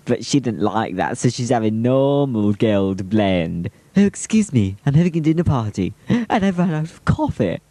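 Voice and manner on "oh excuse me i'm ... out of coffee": lightly put-on higher voice, a touch brisk; mock-polite dinner party host, slightly raised pitch; hammed-up advert character. Filmer quotes a coffee-seeking dinner host, lightly put-on voice.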